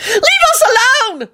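piercingly high screech; very high, desperate cry; tearful screech. A narrator's reading. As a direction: screech it on the edge of tears. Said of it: "Elizabeth screeched and burst into tears".

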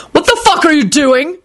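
high angry bellow. Acted. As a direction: bellow at him. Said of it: "Quentin bellowed".

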